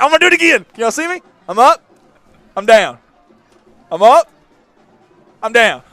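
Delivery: high, loud calls of up and down; excited calling out. Real, not acted. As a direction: bounce and shout for the camera. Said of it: "I love this".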